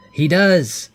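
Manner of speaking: high, loud burst of excitement; excited reveal. Real, not acted. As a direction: announce the big hit with a jolt. Game master reveals a critical hit.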